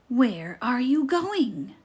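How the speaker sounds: a mild mock growl, drawn out; growled question. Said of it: "growled the wolf".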